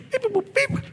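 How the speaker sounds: high beeping noises; robot beeps. Shown as a demonstration. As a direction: beep and boop like a robot. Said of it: "do that".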